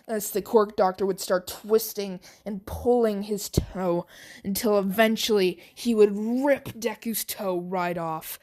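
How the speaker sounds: ordinary delivery, nothing marked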